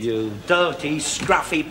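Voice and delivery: ordinary talk, nothing marked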